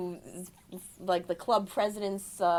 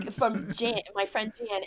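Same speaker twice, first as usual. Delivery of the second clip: ordinary talk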